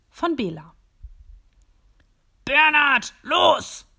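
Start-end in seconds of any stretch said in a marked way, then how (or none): 2.4-3.8 shouting. Acted. Boss shouts order at elf.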